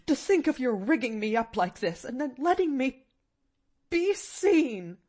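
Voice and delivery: moaning indignation. A narrator's reading. Aunt mortified at being seen, moaning indignantly. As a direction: wail in dismay, rising at the end. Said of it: "moaned the woman".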